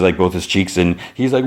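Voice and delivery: ordinary talk, nothing marked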